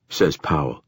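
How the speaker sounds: usual speaking voice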